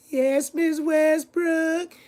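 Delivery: very high, drawn-out mock-sweet reply; sucking-up pupil. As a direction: squeak it slowly like a teacher's pet. Mimics pupils sucking up to teacher.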